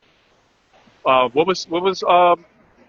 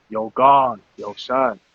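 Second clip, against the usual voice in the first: low New York accent impression; mock New York accent; 3 semitones lower, slower than usual. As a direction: put on a low-pitched New York accent, imitating a buddy. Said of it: "he didn't do the New York accent".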